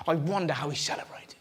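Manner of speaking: conspiratorial whisper. Real, not acted. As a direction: lean in and whisper it. Aside whisper to audience.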